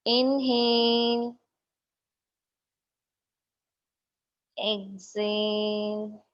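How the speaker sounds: slow, drawn-out meditation cues; guided breathing. Real, not acted. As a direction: stretch "inhale" and "exhale" into long, steady tones. Leads slow breathing exercise.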